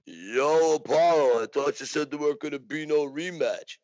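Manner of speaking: a light Rocky Balboa impression, a touch rough and street; calling out across the room as Rocky, lightly voiced. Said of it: "in my best Rocky voice".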